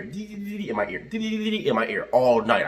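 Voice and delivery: high, slow, drawn-out whine on "in my ear"; mock whiny drawl. Driver mocking a bug whining in his ear, high drawn-out whine. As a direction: stretch it out like a bug buzzing in your ear.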